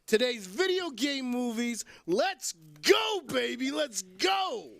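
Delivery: hyped shout. Acted. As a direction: fire up the room. Hyping the episode start.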